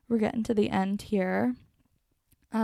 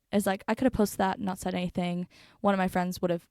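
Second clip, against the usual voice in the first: ordinary talk, nothing marked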